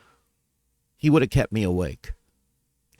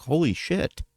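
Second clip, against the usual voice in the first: shocked disbelief; 5 semitones higher than usual. Retold or imitated. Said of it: "I was like".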